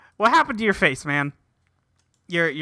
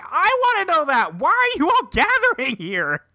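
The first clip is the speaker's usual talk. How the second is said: high mock voice; 10 semitones higher than usual. Character voiced in recap.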